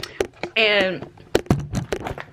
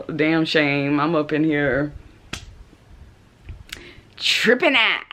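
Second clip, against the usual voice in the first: usual speaking voice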